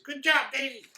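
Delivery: high, fond little driver; chirpy praise for the reindeer; gnome driver voice. Acted.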